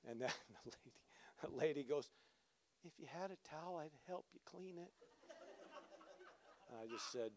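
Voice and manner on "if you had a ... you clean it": very soft, sheepish voice; timid offer. Retold or imitated. Embarrassed dog owner offering to help clean, meek hushed voice. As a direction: offer it meekly, barely audible.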